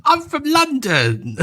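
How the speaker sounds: hammed-up theatrical London accent, high and slow; mock British accent. Guest jokingly introduces himself in a mock London accent. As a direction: play a camp London stage accent. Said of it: "What was that accent?".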